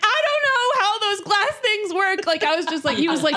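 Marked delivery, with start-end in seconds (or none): 0.0-2.3 hammed-up high tearful wail; crying. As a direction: imitate helpless sobbing.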